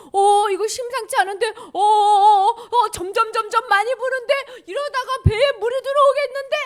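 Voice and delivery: high, loud rising alarm; alarmed disciples. Acted. Disciples watching the wind rise over their boat, acted alarm. Acts disciples as storm rises.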